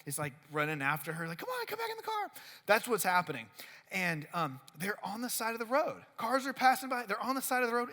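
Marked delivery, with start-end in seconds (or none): 1.4-2.3 much higher, pleading call; pleading boyfriend. Retold or imitated. Boyfriend running after girlfriend begging her back, high pleading. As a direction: chase her with a whiny plea.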